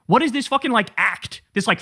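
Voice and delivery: usual speaking voice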